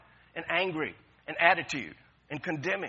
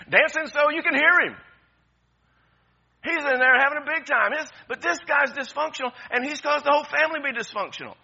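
high, loud preacher's build; fired-up sermon delivery; full-force preaching; 10 semitones higher, 9 dB louder than usual. Acted. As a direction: preach it out, rising joy.

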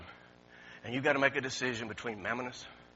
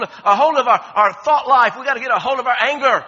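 rising, louder preaching build; preaching at full force; 7 semitones higher, 13 dB louder than usual. Real, not acted. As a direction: drive it home to the congregation, louder each line.

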